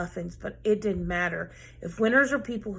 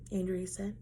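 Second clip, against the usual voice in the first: usual speaking voice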